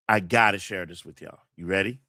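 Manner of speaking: ordinary talk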